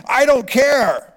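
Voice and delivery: high, loud, drawn-out insistence; exasperated, stretched-out yell; emphatic dismissal. Acted. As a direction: shout it slowly to end the argument. Emphatic repeat of his reply.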